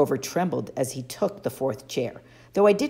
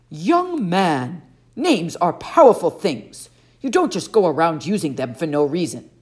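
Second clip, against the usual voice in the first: put-on louder, haughty god scolding a boy; stern camp director; 2 semitones higher, 6 dB louder than usual. Camp director scolding Percy in a loud, haughty voice. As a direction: talk down to him like a disdainful elder.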